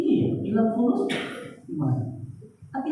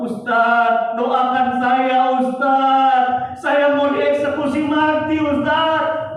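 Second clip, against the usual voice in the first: pleading plea; 5 semitones higher, 6 dB louder, much slower than usual. Retold or imitated. Condemned man begs the preacher.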